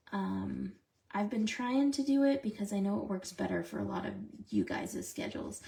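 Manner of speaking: ordinary talk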